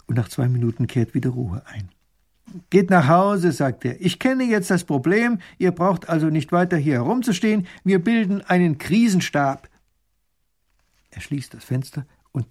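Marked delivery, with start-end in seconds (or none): 2.7-3.5 high, loud order; loud call from a window. A narrator's reading. Ruler calling down to the crowd. 4.0-9.7 high, loud announcement; raised, commanding public voice; calling to crowd. A narrator's reading. Ruler addresses crowd from window.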